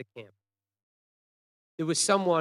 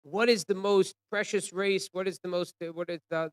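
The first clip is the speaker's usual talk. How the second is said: Nazis calling out; 5 semitones higher, 5 dB louder than usual. Retold or imitated. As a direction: call the question out to a crowd. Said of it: "the Nazis call out".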